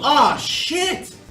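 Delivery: high, sudden yelp; startled curse. Real, not acted. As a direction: caught off guard. Taking a hit in battle.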